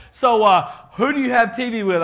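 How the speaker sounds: loud and high, pressing a customer; a loud mock pushy clipboard saleswoman; pushy salesperson. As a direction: corner him with a brash sales pitch. Pushy Comcast saleswoman questioning him.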